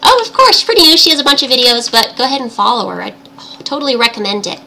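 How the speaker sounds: usual speaking voice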